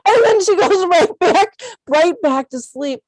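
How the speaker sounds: very high, loud, overcome with emotion; emotional laugh breaking into a high, strained voice; choked up. Real, not acted. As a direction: squeeze it out between laughing and crying. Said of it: "get choked up saying this".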